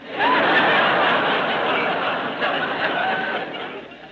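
high unvoiced burst of laughter; real laughter at Don's joke. Real, not acted. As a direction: crack up at the joke.

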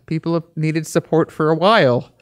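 ordinary delivery

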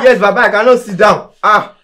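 loud, high-pitched desperate protest; panicked pleading. Acted. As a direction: cry out in desperate refusal. Panicked refusal to sit before prophet.

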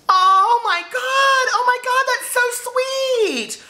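very high, loud, slow squeal; touched excited squeal. Real, not acted. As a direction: gush with delight. Touched by the extra copy.

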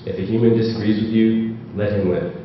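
normal talking voice

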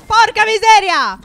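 loud, high, drawn-out outburst; real yell. Real, not acted. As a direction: yell the curse out, slow and full force. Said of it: "Eh, non urlare, eh?".

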